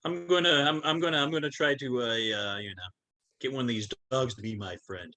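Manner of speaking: usual speaking voice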